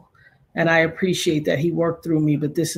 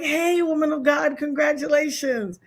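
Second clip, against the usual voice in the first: high, singsong celebratory greeting; joyful congratulation; 10 semitones higher than usual. Retold or imitated. Host retells congratulating Kayla by phone, joyful. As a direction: re-enact a gleeful high hello.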